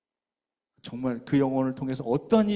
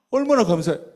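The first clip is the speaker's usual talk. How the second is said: 얼마나 감사해요 called out loud, with 얼마나 high and strong; loud thanks; 6 semitones higher, 8 dB louder than usual. Real, not acted. Pastor tells the church of a convert, loud exclamation. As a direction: proclaim 얼마나 감사해요 with full force.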